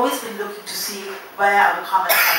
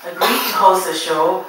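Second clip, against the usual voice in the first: announcer; 11 dB louder than usual. Acted. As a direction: announce it big to the hall. Announcing the show host loudly.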